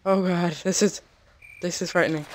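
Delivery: ordinary talk, nothing marked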